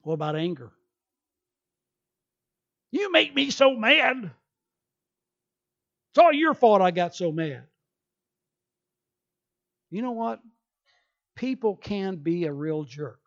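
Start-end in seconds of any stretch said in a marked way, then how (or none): 2.9-7.7 hammed-up angry accusation; mock angry outburst. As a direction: act out blaming someone in anger. Voicing an angry person.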